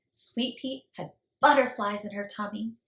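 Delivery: usual speaking voice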